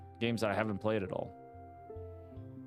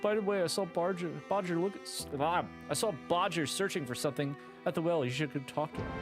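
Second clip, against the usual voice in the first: higher, cheerful shop NPC; grateful shopkeeper; 6 semitones higher than usual. Acted. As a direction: thank the hero brightly.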